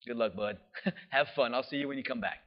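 low, casual, dismissive goodbye; breezy, uncaring farewell, played for irony; offhand mock send-off. Mocking an indifferent shepherd sending off sheep.

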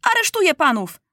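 high-pitched royal outburst; crying out. A narrator's reading. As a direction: call out the arrest in a high voice. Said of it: "krzyknął Maciuś".

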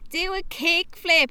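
slow, high mocking kid voice; kids' taunt. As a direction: imitate teens heckling a skater. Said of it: "young ones that were like".

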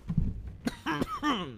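rough throat-clearing cough; coughing up water. Real, not acted. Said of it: "Went down the wrong pipe".

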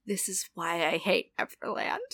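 low, quiet grumbling character voice; put-on voice. Repeated catchphrase in put-on voice.

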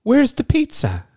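very high, slow, baffled mock voice; confused customer. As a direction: stretch it out like a lost customer. Retelling confused customer at Subway.

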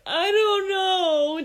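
slow, high, loud mock whine; wailing hurt child. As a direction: drag it out like a bawling kid. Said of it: "And he, she's like".

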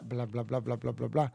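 low, soft monotone trail; dismissive blah-blah. Retold or imitated. Mimicking fans' expected objections, low dismissive drone.

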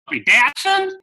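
high, loud puzzled Southern "Datsun?"; a drawling Southern junk dealer baffled; mock Southern yard man. Wrecking yard man on the phone, mock Southern puzzlement. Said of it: "I can't do a good Southern accent".

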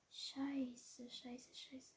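whispered panicked swearing; panicking gamer. Real, not acted. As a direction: whisper so the enemy can't hear. Hiding from nearby enemy.

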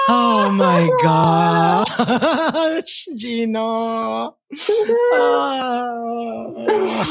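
wheezing through tears of laughter; helpless laughing cry. Real, not acted. Laughing at friend's joke.

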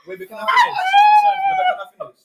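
high, loud demand to finish; shouted protest. Real, not acted. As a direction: yell to get the floor back.

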